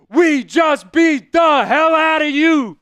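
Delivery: slow, loud, high yell; gloating yell. Real, not acted. Streamer yelling at Cougars fans, gloating. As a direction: hammer every word home.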